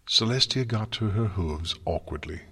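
ordinary delivery

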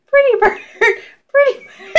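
very high little imitated voice, breaking into laughter; mock blind kid. Blind kid petting his taped bird, high mock voice. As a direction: squeak it sweetly, then crack up.

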